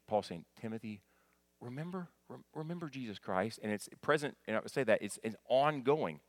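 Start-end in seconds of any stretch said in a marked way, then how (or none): 0.6-3.6 soft, gently urging reminder; Paul gently urging Timothy, much softer; gentle command. Retold or imitated. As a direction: coax him softly to remember. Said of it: "It's a gentle command".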